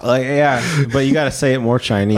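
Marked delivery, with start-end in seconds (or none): none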